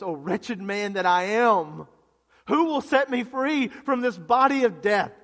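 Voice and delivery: impassioned plea at full preaching volume; anguished cry. Acted. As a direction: cry out in desperation for rescue. Acting out Paul's despairing cry.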